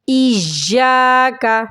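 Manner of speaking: ایا کا with the ya held and pressed; stress on the doubled ya. Shown as a demonstration. Teacher showing students the stressed ya. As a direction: lean hard on the shadda in ایا کا. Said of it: "زور لگائیں گے کیونکہ شد ہے".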